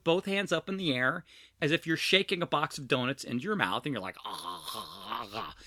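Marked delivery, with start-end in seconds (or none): 4.2-5.5 low, soft open-mouthed "ah" noises; Homer Simpson gobbling. Retold or imitated. Host retelling a kids' donut joke, mock gobbling noises. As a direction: imitate greedily shaking donuts into your mouth. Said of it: "I used to do the Homer Simpson".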